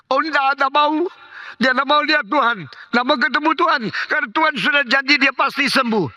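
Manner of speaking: high-pitched, insistent protest, rushing toward the end; frantic refusal. Retold or imitated. Wife refusing pastor's warning about her dying husband, frantic protest. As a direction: voice a wife shrilly refusing to hear it.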